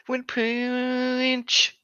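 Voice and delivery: drawn-out, very slow and high; slow dramatic repeat. Pretend. Friend milking the joke phrase with a slow, drawn-out delivery. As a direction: stretch every word for comic weight.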